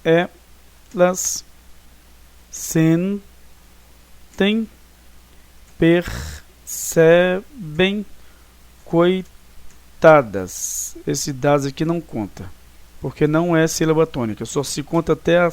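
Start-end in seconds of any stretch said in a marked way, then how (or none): 0.0-1.4 "elas" broken by a pause into "e" and "las"; split into syllables. Shown as a demonstration. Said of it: "aí nós separamos aqui". 2.5-11.0 "sentem, percebem, coitadas" very slow, each syllable separated. Shown as a demonstration. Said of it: "aí nós separamos aqui".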